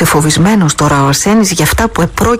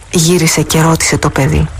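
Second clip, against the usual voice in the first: ordinary delivery, nothing marked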